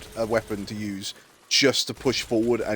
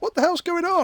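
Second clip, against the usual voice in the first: high surprised. Retold or imitated. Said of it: "I went".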